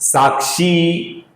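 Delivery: साक्षी with the last "ee" drawn out; long final vowel. Shown as a demonstration. Teacher showing which is right, long दीर्घ ending. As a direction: slowly hold the end of साक्षी. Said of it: "ये सही है".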